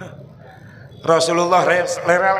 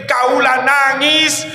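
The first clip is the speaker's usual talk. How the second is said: tearful cry; 7 semitones higher, slower than usual. Acted. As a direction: cry it out, aching for your people. Prophet weeping, longing for people.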